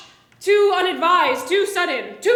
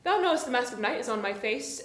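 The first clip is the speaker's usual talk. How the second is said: ordinary talk, nothing marked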